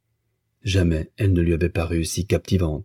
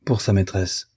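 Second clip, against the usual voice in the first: ordinary delivery, nothing marked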